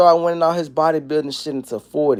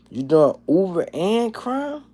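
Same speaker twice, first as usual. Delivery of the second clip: incredulous; 6 semitones higher, slower than usual. Real, not acted. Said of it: "disbelief at viewer's comment".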